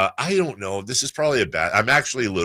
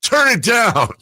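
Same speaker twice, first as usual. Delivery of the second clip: loud, high-pitched imitation yell; angry dad yelling; 8 dB louder than usual. Dad bursting into his kid's room over music, yelling. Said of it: "my dad kicks open my door and goes".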